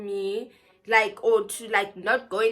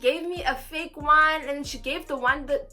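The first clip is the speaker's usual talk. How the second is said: higher, urgent begging; pleading; 5 semitones higher, 5 dB louder than usual. Retold or imitated. Begging the ticket scanner, higher and urgent. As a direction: plead with the scanner to let her in.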